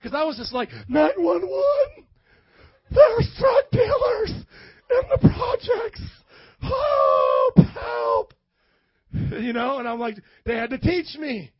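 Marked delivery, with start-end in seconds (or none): 0.9-8.3 mock panic. Panicked naive 911 call mock.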